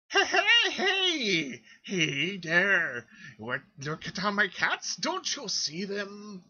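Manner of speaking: high, loud cackling crone; toothless old lady. Acted. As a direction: shout it like a gummy old cat lady. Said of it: "gives you, like, this toothless grin".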